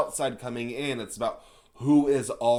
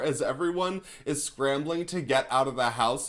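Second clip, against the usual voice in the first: ordinary talk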